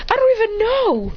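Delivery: high-pitched bewilderment; baffled reply. Acted. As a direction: answer high, utterly at a loss. Baffled reply in acted story.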